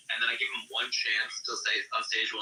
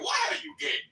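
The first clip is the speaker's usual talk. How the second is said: lightly put-on Nigerian accent, high pitch; high-pitched attempt at a Nigerian accent. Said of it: "do it with a Nigerian accent".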